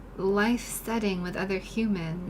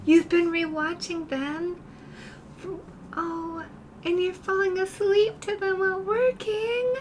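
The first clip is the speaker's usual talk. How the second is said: touched; 10 semitones higher than usual. Real, not acted. Touched by Ruthie's message.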